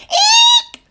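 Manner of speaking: piercing high owl "eek"; very loud screech. Acted. Young owl startling a squirrel below, very loud screech. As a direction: let out your loudest, highest screech. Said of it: "let out his very loudest".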